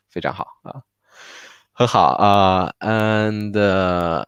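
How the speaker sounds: ordinary talk, nothing marked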